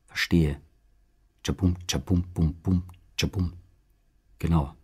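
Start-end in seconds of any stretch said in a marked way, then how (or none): 1.4-3.5 hushed drum sounds. Acted. Soft drum-beat sound poem.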